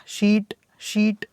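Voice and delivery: शीट twice, each with a long ee; long vowel. Shown as a demonstration. Teacher repeating the word for students, long vowel. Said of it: "इसका sound long है".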